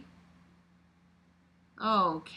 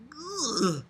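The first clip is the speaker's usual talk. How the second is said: ordinary talk, nothing marked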